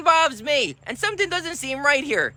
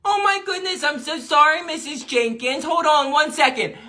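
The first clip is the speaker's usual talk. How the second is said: usual speaking voice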